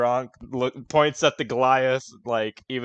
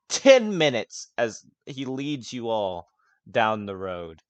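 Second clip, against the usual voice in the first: ordinary delivery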